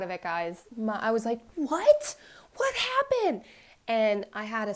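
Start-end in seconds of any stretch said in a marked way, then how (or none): 1.5-3.4 high, loud shocked demand; retold shrill-pitched yell of disbelief; raised voice. Mother's initial reaction to daughter's news, raised voice. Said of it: "I'm sorry I raised my voice".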